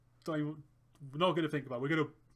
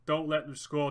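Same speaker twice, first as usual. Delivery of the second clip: usual speaking voice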